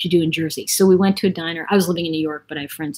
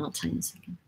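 usual speaking voice

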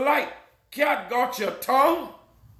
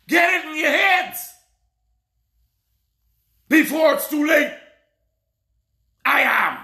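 full-force preaching; 4 semitones higher than usual. Acted. Preacher warning his flock about life and death, shouting. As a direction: thunder warnings at the congregation.

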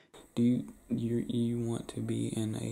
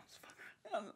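giggly whisper; 11 semitones higher, 11 dB quieter than usual. Real, not acted. Laughing off own joke.

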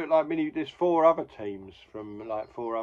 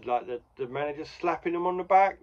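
usual speaking voice

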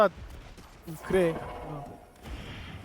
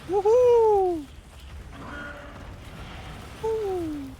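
long excited yell; 7 semitones higher, 4 dB louder, much slower than usual. Real, not acted. Excited whoops during fight.

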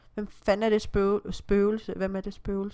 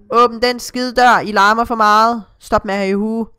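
angry complaint; 3 semitones higher, 9 dB louder than usual. Acted. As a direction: complain loudly through a door. Voices angry neighbour at door. (højlydt, spillet brok)

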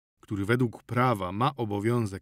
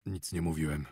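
low, softer, withdrawn; quiet denial; 6 semitones lower, 6 dB quieter than usual. Acted. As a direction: mutter the denial. Healer denies quietly. (cicho, zamknięty w sobie)

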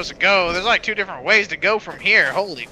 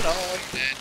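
ordinary delivery

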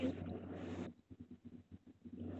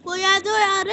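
calling out; 4 semitones higher, 9 dB louder than usual. Real, not acted. Calling teammates for help.

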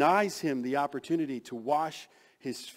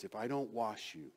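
quiet warning; 5 semitones lower, 7 dB quieter than usual. Acted. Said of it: "responds to his statement with a warning".

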